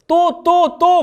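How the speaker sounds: put-on nagging older sister. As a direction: scold her like a fed-up big sister. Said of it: "대다수 언니들이 뭐라 그래요?".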